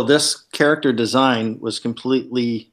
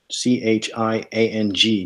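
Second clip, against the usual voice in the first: normal talking voice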